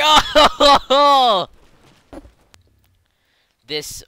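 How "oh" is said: pulsing, laughing "oh-ho-ho"; loud high whoop. Real, not acted. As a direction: burst out in a loud high hoot. Bursts out at the crash.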